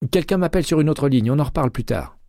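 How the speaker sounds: voix de Jay plus aiguë, enjouée; Jay au téléphone. A narrator's reading. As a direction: interlocutrice jouée un ton plus haut, légèrement animée. Jay raccroche vite. (Jay, inspectrice, répond à McCaleb au téléphone, voix plus haute)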